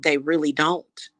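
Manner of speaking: normal talking voice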